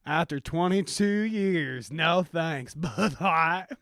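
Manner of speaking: slow, loud Southern bumpkin; Southern country accent. Acted. Longtime drinker rejecting Bud Light, Southern country accent. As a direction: draw it out in a mock country accent. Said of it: "country accent and my rendition of a Southern".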